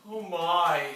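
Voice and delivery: ordinary talk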